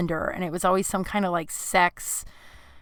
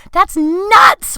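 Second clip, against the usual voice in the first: much higher, loud child's shriek, imitated; bloody murder scream; 8 dB louder, much slower than usual. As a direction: shriek it like a terrified little girl refusing a balloon. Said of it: "And I'd bloody murder".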